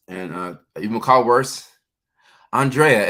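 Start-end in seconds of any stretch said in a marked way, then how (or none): 2.5-3.1 Andrea as ahn-DRAY-uh, loud; careful pronunciation. Real, not acted. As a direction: say "Andrea" with an open "ah" start and a DRAY middle. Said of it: "now it's easy name".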